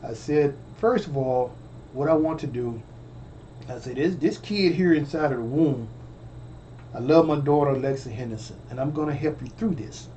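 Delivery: low, soft, soothing tone; reassuring calm voice to a scared woman; calm relaxing voice. Retold or imitated. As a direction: talk her down gently. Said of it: "So I had a very calm, relaxing voice".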